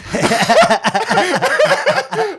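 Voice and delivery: high, loud burst of laughter. Real, not acted. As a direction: crack up at your own impression. Laughing at own impression.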